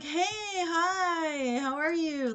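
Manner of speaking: high-pitched, drawn-out put-on politeness; forced friendly greeting. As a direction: greet them too sweetly, hiding your shock. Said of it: "Like, shocked in my mind".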